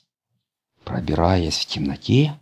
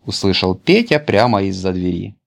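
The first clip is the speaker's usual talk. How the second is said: plain reading voice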